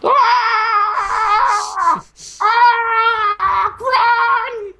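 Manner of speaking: high loud mock screams of fleeing troops; panicked shout. Little British soldiers fleeing giant creatures, high panicked shouts.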